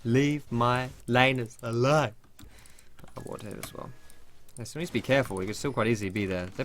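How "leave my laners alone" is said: mock jungler warning. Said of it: "just be like".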